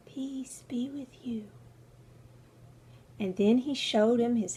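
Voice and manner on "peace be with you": gentle whisper. Acted. Jesus greets the disciples.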